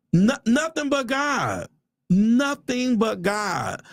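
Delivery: high, slow church testimony; exultant praise, stretched wide; fervent testifying. Real, not acted. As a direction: proclaim it drawn out, like a preacher. Testifying to a blessing.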